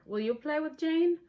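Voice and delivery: ordinary delivery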